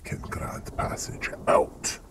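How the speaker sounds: vampire lord whisper. Acted. Strahd whispers his offer.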